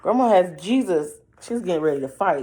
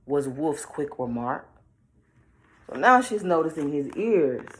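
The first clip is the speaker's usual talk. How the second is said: plain reading voice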